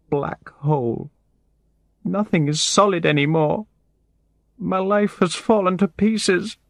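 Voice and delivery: very high, tearful woman's voice; high-pitched, desperate tearful outpouring; distraught crying voice. A narrator's reading. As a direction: act a woman breaking down in tears. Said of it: "She stopped crying".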